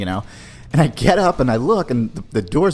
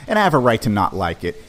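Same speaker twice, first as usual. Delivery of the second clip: normal talking voice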